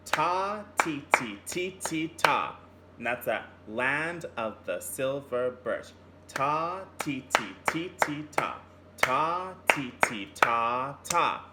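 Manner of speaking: chanted ta and ti rhythm; clapped-out ta ti rhythm chant; rhythm syllables. Shown as a demonstration. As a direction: chant "Ta, ti, ti" beat by beat. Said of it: "the first part of the song goes like this".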